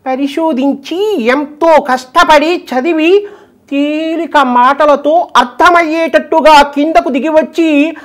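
high, slow pravachanam voice; high measured spiritual discourse delivery; Garikapati impression. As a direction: preach it slowly like the Telugu discourse master. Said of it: "మన గరికపాటి గారు".

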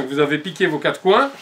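normal talking voice

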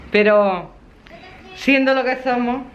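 ordinary talk, nothing marked

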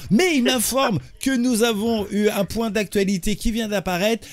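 high, bright TV anchor voice; news anchor. Acted. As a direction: announce it like a newsreader. Parody TV news anchor intro.

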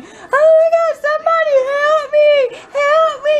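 hammed-up panicked scream for help; mock screaming. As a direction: scream like a kid who stepped on a needle. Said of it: "and he was screaming".

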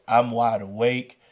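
ordinary delivery, nothing marked

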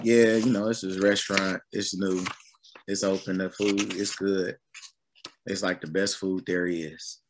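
low, subdued mock sales pitch; unconvincing. Preacher mimicking someone recommending a restaurant without excitement, unconvincing. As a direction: act out a half-hearted recommendation, quiet and low. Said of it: "You don't sound too convincing".

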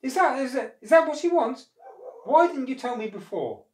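high, loud, stumbling bluff; thinking fast. A narrator's reading. Monkey improvising to save his life, bluffing. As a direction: cover alarm with breezy questions. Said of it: "thinking fast".

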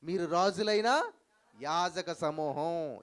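very slow, loud, drawn-out words; solemn scripture quote. Retold or imitated. Preacher quoting Peter to the congregation, slow and loud. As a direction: lay out each word slowly like a decree. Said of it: "పేతురు అంటాడు".

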